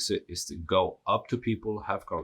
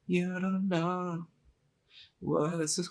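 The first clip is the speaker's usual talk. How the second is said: very high, drawn-out put-on voice; mock them in a high, slow voice; mock imitation; 12 semitones higher, slower than usual. Reader jokingly voicing the accent he assumes, high mock imitation.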